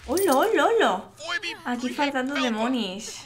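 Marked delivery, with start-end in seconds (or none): 0.1-1.1 excited greeting. Real, not acted. Greeting onscreen Sims.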